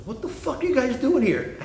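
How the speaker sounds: high-pitched retold outburst; incredulous challenge. Ambulance guy challenging two bikers at a light, high-pitched and incredulous. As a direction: mimic an alarmed, incredulous stranger, voice way up.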